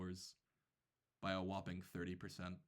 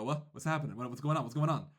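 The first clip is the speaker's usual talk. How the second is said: alarmed confusion; 4 semitones higher, 7 dB louder, faster than usual. Retold or imitated. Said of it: "I look at him and I go".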